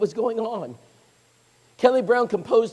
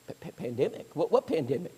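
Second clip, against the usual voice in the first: quiet, lower, stammering bewilderment; stunned stammer; 3 semitones lower, 8 dB quieter than usual. Retold or imitated. Brother hearing of the pandemic, quiet baffled stammer. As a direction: stumble over the word, baffled. Clueless brother hears news.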